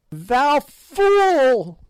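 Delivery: high, loud witch's screech; furious hag's scream; shrieked. A narrator's reading. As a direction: screech the insult at him. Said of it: "shrieked the old fiend".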